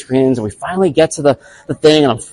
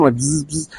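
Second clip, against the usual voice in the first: soft mock buzzing; soft buzzer noise; 3 semitones higher, 5 dB quieter than usual. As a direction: hum it like clippers. Imitating the buzzer.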